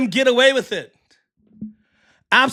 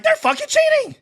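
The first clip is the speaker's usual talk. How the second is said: much higher, a retold cry of disbelief; mock outraged squeal. Streamer retelling his reaction to the refs, high mock outrage. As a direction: squeal it like it just hit you. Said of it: "the more I was like".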